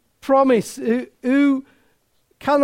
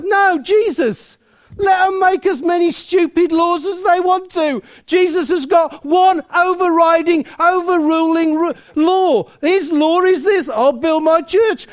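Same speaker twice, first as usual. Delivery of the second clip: bold declaration; 7 semitones higher, 4 dB louder than usual. Acted. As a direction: proclaim it with full conviction. Preacher voices bold faith.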